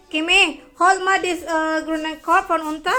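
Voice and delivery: calling across the house. Real, not acted. Mother calling child from another room.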